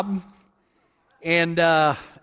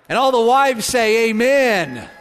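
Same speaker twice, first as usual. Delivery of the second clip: rallying shout; 4 semitones higher, 6 dB louder, slower than usual. Real, not acted. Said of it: "That's probably the biggest shout I've ever gotten".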